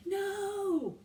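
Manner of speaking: high, sharp yell. A narrator's reading. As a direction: yell to stop the throw. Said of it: "yelled Annie".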